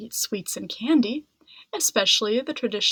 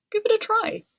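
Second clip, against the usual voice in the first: encouraging poet; 11 semitones higher, faster than usual. A narrator's reading. Vyasa urges Ganesha.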